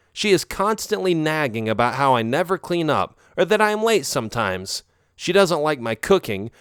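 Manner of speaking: a touch of a sympathetic gossip; friendly neighbour. Acted. Cammy comforts the lonely narrator, lightly voiced chatty friend.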